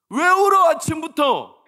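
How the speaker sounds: 아침부터 thrown out high and loud, falling at the end; irritated yelling. Retold or imitated. Father yelling at crying daughter, high loud 아침부터. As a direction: bark 아침부터 high and fast like a fed-up dad. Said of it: "나도 막 짜증이 나가지고".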